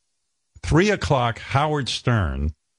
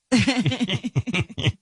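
high laugh; 12 semitones higher than usual. Real, not acted. Big laugh after own bit.

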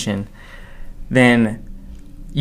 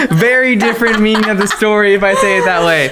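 high giggling laugh, then talking through it; 11 semitones higher, 3 dB louder than usual. Real, not acted. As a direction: laugh it off as you speak. Laughing at own slip.

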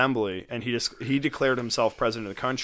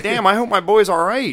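worried outburst; 9 semitones higher, 10 dB louder than usual. Retold or imitated. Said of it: "I'm like".

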